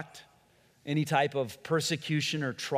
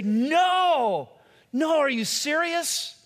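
higher, louder stunned no; shocked disbelief; 11 semitones higher, 5 dB louder, much slower than usual. Acted. Friend reacting to a cancer diagnosis, stunned. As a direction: gasp it out like hearing terrible news. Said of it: "You'd say".

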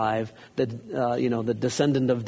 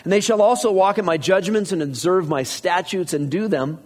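raised, steady reading of scripture; 4 semitones higher than usual. Acted. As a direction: read it out to the congregation. Reads scripture aloud.

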